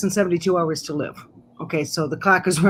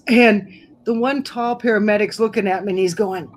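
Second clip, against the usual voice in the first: usual speaking voice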